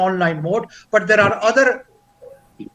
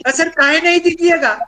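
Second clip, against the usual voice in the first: higher, quick acted question; protesting participant; 8 semitones higher, 4 dB louder, much faster than usual. Participant asks trainer why he refuses the copies, quick protest.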